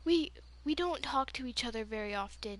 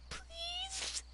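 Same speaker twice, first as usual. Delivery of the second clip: tiny, very high, soft beg; mock-sweet little plea; pleading; 10 dB quieter than usual. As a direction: squeak out a hopeful request. Begging friends to beat him up.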